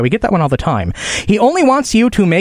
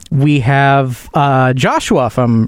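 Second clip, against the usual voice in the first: usual speaking voice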